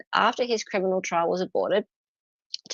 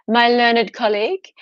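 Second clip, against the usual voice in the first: louder, pompous courtroom address; lightly mock a pompous lawyer; posh barrister; 3 semitones higher, 7 dB louder than usual. Mocking barristers addressing each other, pompous and loud. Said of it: "they even say".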